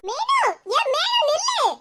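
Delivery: slow, high-pitched calling. Acted. Friend calling Menaka to stop, high drawn-out call. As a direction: stretch the name to halt a runaway.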